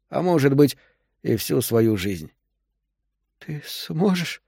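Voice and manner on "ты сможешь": high, very slow, strained words; forced out. A narrator's reading. Woman in labour asks the doctor's help, high strained voice. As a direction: squeeze the words out with effort. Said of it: "выдавила Вера".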